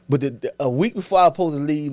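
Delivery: ordinary talk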